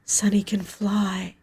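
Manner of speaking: slow unvoiced whisper; slow hushed whisper of comfort. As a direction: breathe it slowly like a secret comfort.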